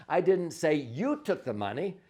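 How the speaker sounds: you stressed with a rise. Shown as a demonstration. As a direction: lean on you as the accused. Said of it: "emphasize each one of the words differently".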